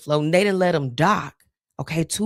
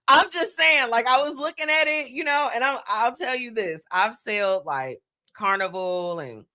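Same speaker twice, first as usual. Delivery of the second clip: normal talking voice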